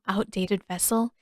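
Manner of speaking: usual speaking voice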